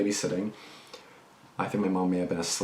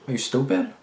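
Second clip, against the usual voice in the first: higher, incredulous jab; scornful; 5 semitones higher, 4 dB louder than usual. Real, not acted. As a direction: ask it with open scorn. Scornful rhetorical question.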